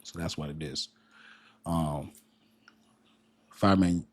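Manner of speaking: normal talking voice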